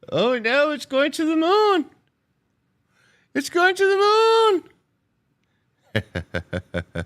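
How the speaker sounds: very high, loud, slow squeal; mock moon hype. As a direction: scream it like a hyped fan.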